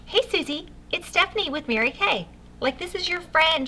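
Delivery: the wrong way, too formal for a friend; overly formal introduction. Shown as a demonstration. Consultant calling a friend, overly formal and businesslike. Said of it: "I did not call her up and say".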